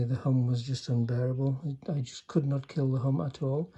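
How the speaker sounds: ordinary delivery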